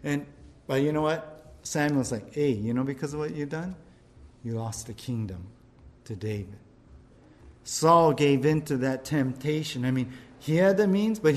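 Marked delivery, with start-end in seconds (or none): 2.3-6.6 Samuel, low and soft, delivering the sentence; stern Samuel. Acted. Preacher voicing Samuel telling Saul he lost the kingdom, low and quiet. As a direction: drop low and quiet as the verdict falls.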